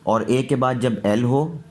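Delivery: ordinary talk, nothing marked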